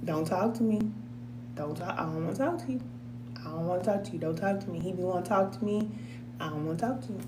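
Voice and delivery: icy mumble, refuse to talk; sulky cold shoulder. Retold or imitated. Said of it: "But if I go up there and clean it".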